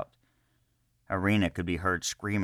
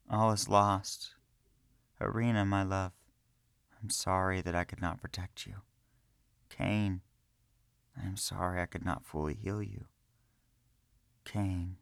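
dying farewell; 5 dB quieter than usual. A narrator's reading.

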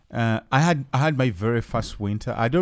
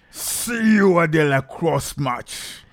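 slow, high, drawn-out challenge voice; 7 semitones higher, 4 dB louder, slower than usual. Acted. Rival tribesman announcing a match to settle a dispute, hammed-up challenge. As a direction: stretch it out like a theatrical challenge. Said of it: "in exactly that voice".

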